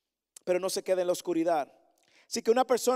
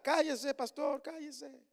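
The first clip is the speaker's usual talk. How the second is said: hushing; 6 semitones higher, 4 dB quieter, much slower than usual. Pretend. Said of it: "empiece a mandarme a callar".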